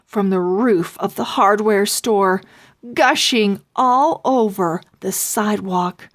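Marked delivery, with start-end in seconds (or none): none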